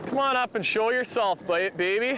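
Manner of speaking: coaxing. Real, not acted. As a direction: sweet-talk the fish up. Coaxing the fish up.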